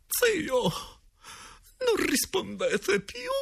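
very high, coaxing falsetto; wheedling high-pitched question; insinuating directress. A narrator's reading. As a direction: coax the silent spirit in a slow, high voice. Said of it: "disse ancora la direttrice con voce insinuante".

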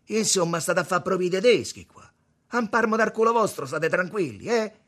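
high, loud scolding; angry reproach. A narrator's reading. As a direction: tell them off loudly. Tommaso rimprovera gli altri. (rimprovero gridato)

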